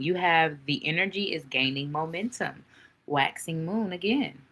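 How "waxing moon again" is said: normal talking voice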